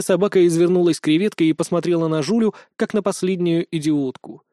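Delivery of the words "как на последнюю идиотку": ordinary delivery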